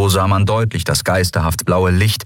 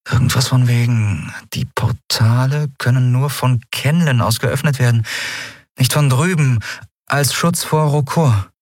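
slightly in character, higher, piecing it together; a hint of a woman remembering; lightly voiced recollection; 6 semitones higher than usual. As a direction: recall it haltingly, lightly acted. Lien recalls an explanation.

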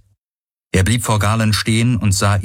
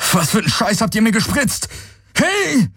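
fast, much higher, furious shouts; furious yelling. A narrator's reading. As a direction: rage at them as they leave. Galen yells after captors. (wütend, schnell, viel höher)

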